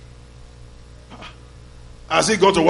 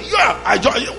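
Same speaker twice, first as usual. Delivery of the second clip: high shout rising to a huge "wow"; imitated shout; 9 semitones higher, 3 dB louder than usual. Preacher recalls joining that man's shout, imitated shout. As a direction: yell it like the man in the crowd. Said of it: "just like that man shouted".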